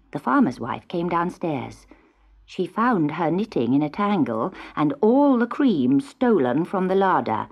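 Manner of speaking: ordinary delivery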